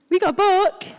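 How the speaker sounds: high, loud mimicry; mocking enthusiasm. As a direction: chirp it mockingly at high pitch. Mimics the others offering a book.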